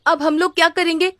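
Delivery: put-on boy fretting about money; worried son. Ganpat asks mother why she gave money, worried.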